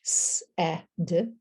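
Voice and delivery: "s, eh, d" as three separate sounds; hissed "s", short "eh", quick "d"; sounded out. Shown as a demonstration. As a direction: segment "s, eh, d" for a learner. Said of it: "But the middle sound".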